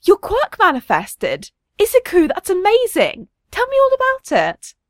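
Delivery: delighted mother, pitch leaping high; thrilled, high-pitched mum; beaming excitement. A narrator's reading. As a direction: gush with proud excitement, voice soaring. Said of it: "she beams in the rearview mirror".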